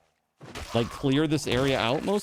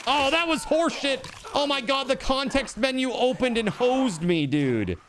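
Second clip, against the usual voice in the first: frustrated outburst; 13 semitones higher than usual. Real, not acted. Game death, frustrated outburst.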